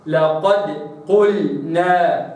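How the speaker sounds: لقد قلنا slow with a thin dal; tajweed recitation. Shown as a demonstration. Teacher models the right لقد قلنا. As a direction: draw out قلنا slowly, dal kept light. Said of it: "وإنما".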